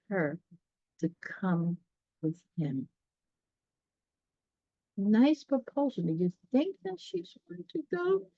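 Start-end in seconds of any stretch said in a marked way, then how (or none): none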